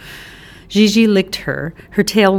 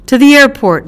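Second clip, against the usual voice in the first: higher, louder giddy order; triumphant order; 7 semitones higher, 7 dB louder, faster than usual. Acted. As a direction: tell the cabbie, elated. Breathless order to cabbie.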